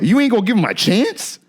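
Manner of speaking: high-pitched rising protest; incredulous. Real, not acted. Pressing rival fans about their starting quarterback, incredulous. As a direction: press the question in real disbelief. Repeats the challenge in disbelief.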